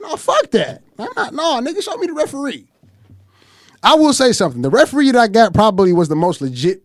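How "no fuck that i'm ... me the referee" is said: high, sharp retold refusal; furious refusal. Refusing the mediator's deal, high and heated. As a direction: shut the deal down hard. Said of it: "I said".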